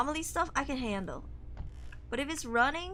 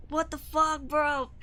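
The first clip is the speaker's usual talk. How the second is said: ordinary talk, nothing marked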